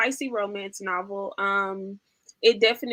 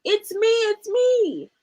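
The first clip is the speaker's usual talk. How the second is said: high, slow, sing-song reveal; squeaky little animal cheering it's me; 10 semitones higher, 4 dB louder, slower than usual. Acted. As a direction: pop out from behind the mask. Duck revealed under mask.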